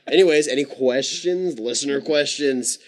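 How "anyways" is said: usual speaking voice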